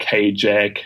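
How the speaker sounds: "Hajek" opening like hay, HAY-jack; another mispronunciation. Shown as a demonstration. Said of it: "uh, I get".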